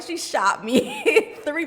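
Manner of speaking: high, loud, laughing mid-sentence; amused, drawn out through laughter. Real, not acted. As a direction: crack up while telling it, slowing down. Laughing at being shot down.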